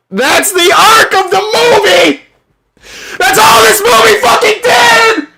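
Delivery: yelling at the top of his voice; furious high-pitched rant; angry shouting. Real, not acted. Ranting about the movie's plot.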